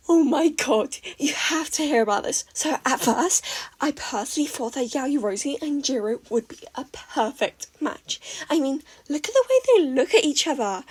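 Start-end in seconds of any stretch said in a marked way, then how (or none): 0.1-2.4 high, loud, breathless gush; gasping excitement. A narrator's reading. May gushing gossip to Izuku, excited. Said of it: "May gasped". 8.5-10.8 higher and louder, eager; excited gush. A narrator's reading. May gushing about her ship, excited.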